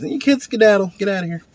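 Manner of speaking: high-pitched playful shooing; shooing kids away. Acted. Man talking to kids, telling them to skedaddle, playful shooing. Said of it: "like, talking to kids".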